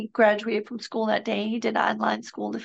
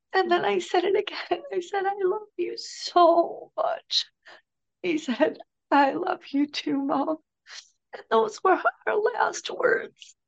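very high voice, breaking with grief; tearful; 7 semitones higher than usual. Real, not acted. Bereaved mother recalling last words with her son, tearful. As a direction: struggle through real tears, drawing words out.

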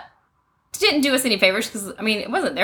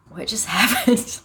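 slow, stunned mimicry of a kid; dazed, lightly acted; dazed look; slower than usual. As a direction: imitate a child emerging in a daze. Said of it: "they have this, like".

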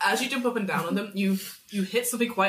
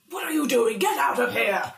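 raised, higher voice ordering intruders out; alarmed Grandmaster; 5 semitones higher, 4 dB louder than usual. Acted. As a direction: caught out, chase them off. Hidden Grandmaster caught out, orders players out.

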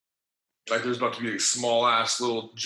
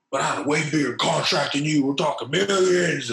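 boastful veteran; 5 semitones higher, 5 dB louder than usual. Retold or imitated. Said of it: "bartender said some shit like".

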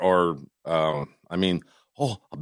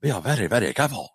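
mock Japanese accent; 2 semitones higher than usual. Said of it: "So he's".

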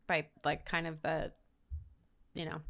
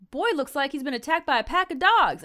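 higher, louder Southern voice; awful Southern accent; 7 semitones higher, 5 dB louder than usual. Retold or imitated. Old dude about the man stumbling away, awful Southern accent. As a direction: ham it up as a Southern old-timer. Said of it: "in awful Southern accents".

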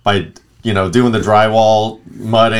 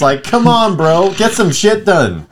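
a hint of a grumpy old foreman, higher pitched; old man; 8 semitones higher, slower than usual. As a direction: nag like a fed-up old boss. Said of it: "That's the old man".

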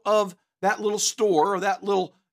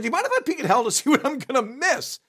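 laughing request; 7 semitones higher than usual. Acted. Man asking Saint Peter in joke.

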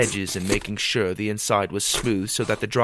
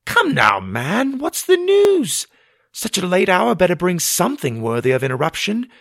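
much higher, louder demanding mother; impatient Ma; 9 semitones higher, 5 dB louder than usual. A narrator's reading. Mother presses the late messenger for news, impatiently. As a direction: push him to spill it.

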